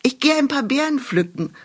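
called out high to mother; calling out. A narrator's reading. Little witch calling to her mother from afar. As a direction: sing it out across the house. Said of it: "rief sie ihrer Mutter zu".

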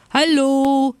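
high, loud call across the snowfield; a cheerful shout from a distance; calling out from afar. A narrator's reading. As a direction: shout a greeting from far away. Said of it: "schrien sie schon von weitem".

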